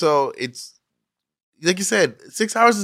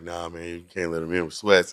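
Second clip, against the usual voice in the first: low, softer mock of a dismissive bouncer; 9 semitones lower, 7 dB quieter than usual. Retelling bouncers turning his cousin away, low mock voice. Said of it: "And they were like".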